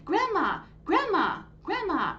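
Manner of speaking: high, loud calls, each one falling; excited yell. Acted. Boy running home calling grandma, loud and thrilled. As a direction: call her across the house, bursting with news. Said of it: "Raheem yelled".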